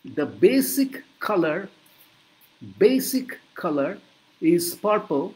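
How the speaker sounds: very slow, clear explanation to a customer; slow explaining. Shown as a demonstration. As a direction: explain the colour slowly. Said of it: "তুমি তাকে বুঝিয়ে বলছো".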